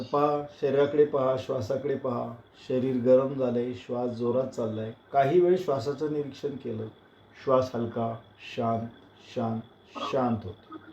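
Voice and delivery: low, soft meditative guidance; guided relaxation. Acted. Yoga teacher has students watch their breath settle, calm and hushed. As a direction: settle them gently, voice dropping towards stillness.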